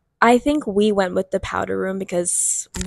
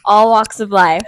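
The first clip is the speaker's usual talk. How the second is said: laughing; 12 semitones higher, 4 dB louder than usual. Real, not acted. Joking welcome, laughing.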